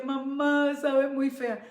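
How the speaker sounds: put-on a touch of a crying girl's whine; lightly imitated tearful daughter; tearful child. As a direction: plead like a teary little girl. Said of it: "lloraba y estaba toda triste".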